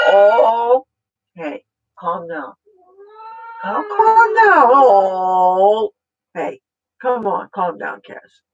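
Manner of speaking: coaxing the pet. Real, not acted. Urging her excited pet Cassie to calm down, coaxing. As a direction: coax an excited pet to settle.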